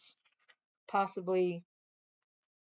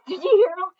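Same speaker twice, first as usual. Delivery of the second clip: surprised gasp; 11 semitones higher, 10 dB louder than usual. Real, not acted. Gasp, excited aside to viewers.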